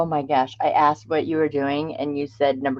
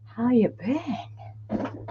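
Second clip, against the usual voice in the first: slow unvoiced whisper; softly whispered repeat of the greeting; 6 semitones higher, 9 dB quieter, much slower than usual. Real, not acted. Greets chat, then repeats it whispered.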